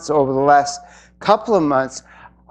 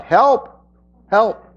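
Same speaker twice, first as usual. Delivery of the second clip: high, pleading cry of help; mock cry for help; 7 semitones higher than usual. Speaker mimics a desperate person crying for help. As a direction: cry out to God in a panic. Calling God in crisis.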